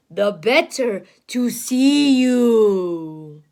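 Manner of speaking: low, very slow wolf; sly wolf. Acted. Wolf answering the girl, low and slow. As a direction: purr it slyly. Wolf's reply, lower.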